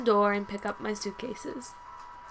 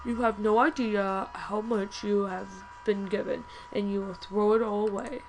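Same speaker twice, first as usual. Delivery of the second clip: disdainful professor. Acted. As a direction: draw out the scorn as a stern teacher. Voices the disapproving professor.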